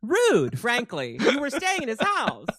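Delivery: smug villain talking down to Rachel; haughty Selene. Acted. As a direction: lecture Rachel like a bored queen. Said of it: "And Selene's like".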